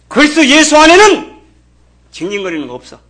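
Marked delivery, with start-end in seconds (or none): 0.1-1.4 high, loud preaching shout; forceful proclamation. Real, not acted. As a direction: proclaim it at full force from the pulpit. Preacher proclaims his climax.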